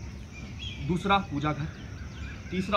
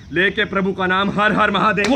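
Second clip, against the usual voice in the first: rallying cry; 5 semitones higher, 9 dB louder than usual. Acted. Rallying cry har har mahadev.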